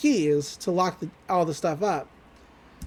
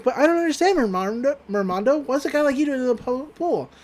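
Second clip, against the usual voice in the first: put-on higher-pitched, puzzled girl voice; Mabel's character voice; 6 semitones higher than usual. Girl questioning the merman in the pool, puzzled high voice.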